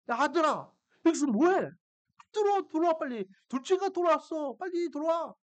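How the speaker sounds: high-pitched, urgent father's call; excited calling. Retold or imitated. Father calling elder son inside to celebrate, excited urging.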